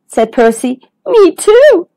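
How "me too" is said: high, worried agreement; scared. Acted. Pufferfish and Turtle inside the whale, scared. Friends share fear in whale.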